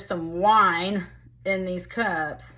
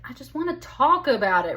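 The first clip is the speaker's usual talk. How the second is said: higher, louder mock protest; pleading protest; 5 semitones higher, 5 dB louder than usual. Voicing the woman who wants to talk, pleading protest. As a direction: plead her case with emphasis. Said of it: "She's like".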